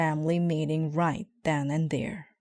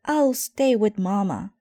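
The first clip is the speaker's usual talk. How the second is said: firm sister; 5 semitones higher, 5 dB louder than usual. Acted. Sister taking charge.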